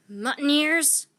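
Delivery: captain yelling at deserting pirates; shouted. A narrator's reading. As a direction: yell after the fleeing crew. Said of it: "shouted Captain Bones".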